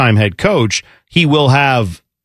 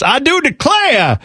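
mock Southern gentleman, pitched way up; Foghorn Leghorn; 10 semitones higher than usual. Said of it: "went full foghorn leghorn".

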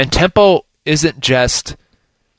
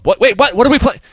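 higher, startled double take; confused panic; 11 semitones higher, 4 dB louder than usual. Retold or imitated. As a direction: caught off guard mid-play. Confused defender caught by snap.

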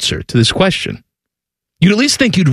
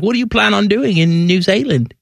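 a hammed-up New Zealand accent, pitched up; 5 semitones higher than usual. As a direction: play a polite New Zealand border officer. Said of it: "do you like my accent".

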